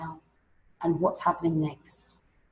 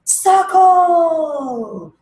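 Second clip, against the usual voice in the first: much higher, louder shout of the word; big reveal; 10 semitones higher, 11 dB louder than usual. Shown as a demonstration. Teacher demoing the reveal after shaking, loud shout. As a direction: burst it out as the lid comes off. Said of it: "Take the lid off".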